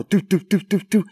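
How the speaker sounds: playful rolling sound; imitated rolling rhythm. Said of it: "this rhythmic noise".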